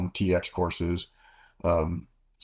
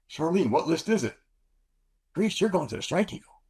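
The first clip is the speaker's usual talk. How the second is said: raised mock dialogue; high imitated exchange; 10 semitones higher than usual. Pilot and liaison trade news, raised voices. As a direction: play both sides brightly.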